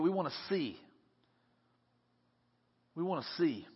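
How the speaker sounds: soft, simple acted request; hushed, earnest wish; quiet plea. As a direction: say it softly and let it sit. Blind men's plea, repeated after pauses.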